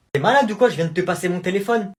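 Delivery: loud, high, indignant yell; outraged yell. Acted. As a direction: blow up at being cheated. Outraged at the phone trick.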